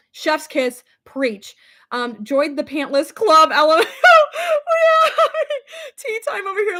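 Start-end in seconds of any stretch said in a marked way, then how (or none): 2.2-6.8 reads it through laughter; laughing read. Real, not acted. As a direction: giggle the words out. Reading comment while laughing.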